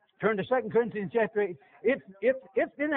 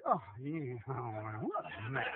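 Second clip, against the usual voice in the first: low, soft grumbling letdown; lightly voiced sigh of disappointment; mock disappointed preacher; 11 semitones lower, 15 dB quieter, slower than usual. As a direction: mutter it like a let-down pastor. Pastor's disappointed remark voiced.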